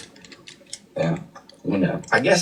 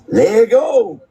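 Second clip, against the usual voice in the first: shouted catchphrase; 13 semitones higher, 5 dB louder than usual. Real, not acted. Energetic sign-off catchphrase.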